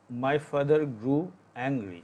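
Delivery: "my father grew angry" read very slowly, word by word; teacher's slow model reading; slow dictation. Shown as a demonstration. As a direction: read it out slowly for students to copy. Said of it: "teacher reads English example slowly".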